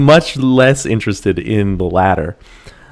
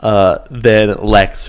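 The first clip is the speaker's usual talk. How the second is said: ordinary delivery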